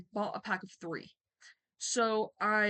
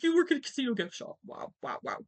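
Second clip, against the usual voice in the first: high-horse scorn; 5 semitones higher, 3 dB louder than usual. Retold or imitated. Mocking an ex for his gift shop job, high-horse scorn. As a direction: act out lofty mockery of someone's job. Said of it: "on my high horse".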